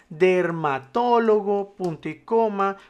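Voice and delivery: slow, drawn-out syllables; typing aloud. Shown as a demonstration. Teacher filling in a list for learners, slow typing-along voice. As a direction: stretch the word out as if typing it.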